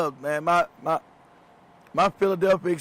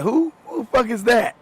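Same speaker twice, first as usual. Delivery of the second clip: higher-pitched retold disbelief; mock incredulous; 6 semitones higher, 4 dB louder than usual. Retelling his reply to a friend about a star, incredulous. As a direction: act out being totally clueless.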